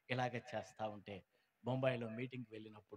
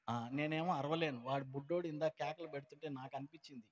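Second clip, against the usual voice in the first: usual speaking voice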